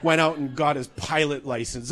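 normal talking voice

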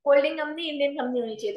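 usual speaking voice

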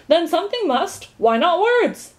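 high, loud, triumphant conclusion. A narrator's reading. Undersecretary wins the argument over Milo, triumphant. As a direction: clinch the argument with a flourish. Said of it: "exclaimed the undersecretary triumphantly".

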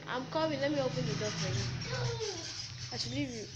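loud call toward the door. Real, not acted. Calling out to someone at door.

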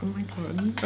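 hushed, whispered reaction; very soft, voiceless aside; whispered disbelief. Real, not acted. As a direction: whisper it as if stunned. Overwhelmed reaction to video.

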